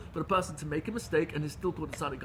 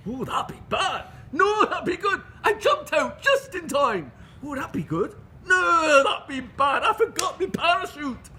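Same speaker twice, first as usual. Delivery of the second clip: put-on southern English accent climbing higher and louder into shouts and laughter; the pub banter spiralling, each reply more hammed-up; southern English farmers, building; 10 semitones higher, 7 dB louder than usual. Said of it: "switch now to a southern, uh, uh, English accent".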